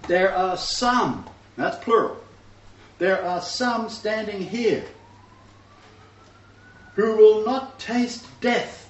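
slow, weighty reading with every phrase stressed; preacher reading Yeshua's words, slow and emphatic; slow emphatic quote. Shown as a demonstration. Preacher quoting scripture slowly to the congregation. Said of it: "this is how it transliterates".

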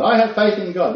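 a loud, high mock boast of faith; mock confident believer. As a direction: parody the smug believer. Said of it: "Christians who have faith".

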